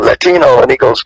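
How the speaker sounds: ordinary talk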